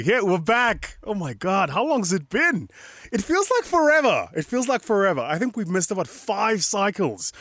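high-pitched, loud excitement; excited return. Real, not acted. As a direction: exclaim like reuniting after far too long. Excited return after a long absence.

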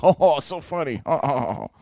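sarcastic fake laughing; hollow put-on ho-ho laugh; mock laughter. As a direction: imitate her laughing, unamused. Mocks her laughing at him.

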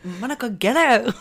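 high, loud mock Irish accent; put-on Irish accent, breaking into laughs. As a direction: imitate a hammed-up Irish exclamation through laughter. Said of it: "with an Irish accent".

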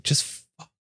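breathy under-the-breath swear; a frustrated curse barely spoken aloud; whispered curse. Real, not acted. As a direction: curse to yourself, unvoiced. Muttered curse at screen.

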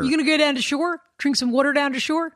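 playful Philadelphia accent, rising questions; Philly accent. Shown as a demonstration. Host hamming up a Philly accent for her co-host. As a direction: ask it like a Jersey Shore local. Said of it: "I like to do accents".

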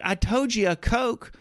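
high, slow exasperated correction; annoyed customer repeating his order, high and slow; exasperated retort. Retold or imitated. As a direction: spell it out slowly and high, fed up. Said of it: "I'm like".

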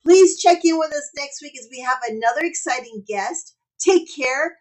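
loud host sign-off read; podcast outro. Acted. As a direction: close the show like an announcer.

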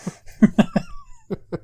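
real shrieking burst of laughter. Real, not acted. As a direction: cackle high at the teasing. Laughing at being mocked.

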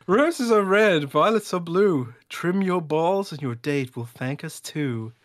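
flirt the sponsor poem at the fellas; Riz voice ad read. Acted. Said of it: "is this your Riz voice".

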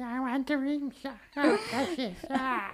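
high, slow, hissing ring-obsessed creature; creepy creature voice. Retold or imitated. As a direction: croon over the ring like a creature. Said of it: "He's like".

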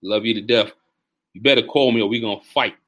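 normal talking voice

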